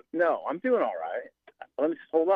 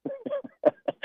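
short laugh. Real, not acted. Laughs after host teases him.

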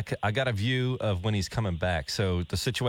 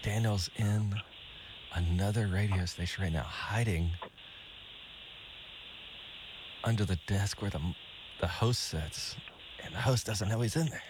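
hushed voice; 7 dB quieter than usual. Real, not acted. Host recaps in whisper.

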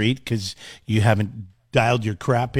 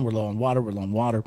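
mocking drone; 6 semitones lower, 9 dB quieter, faster than usual. Mocking repeated official warnings.

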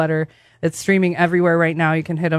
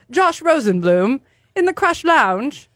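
bad English accent; 7 semitones higher than usual. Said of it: "really bad English accent".

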